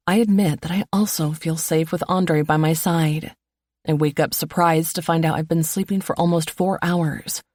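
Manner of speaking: ordinary delivery, nothing marked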